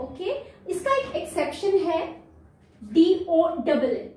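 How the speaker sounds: ordinary talk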